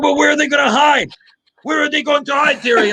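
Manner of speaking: high-pitched imitated French accent. Teammate asking Terry where elephants will hide, high mock French accent. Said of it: "He's saying in his French accent".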